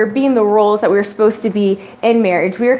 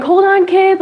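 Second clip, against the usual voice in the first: impatient mom; 7 semitones higher, 4 dB louder, slower than usual. Pretend. Stressed mom cooking.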